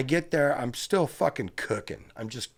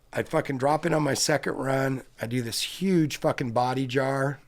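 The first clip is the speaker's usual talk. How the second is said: usual speaking voice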